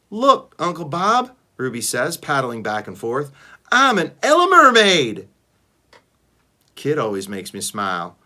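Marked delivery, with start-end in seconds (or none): 0.1-5.3 put-on high, louder excited kid voice; a little girl showing off; playful child. A narrator's reading. Young Ruby showing off to Uncle Bob, high and playful.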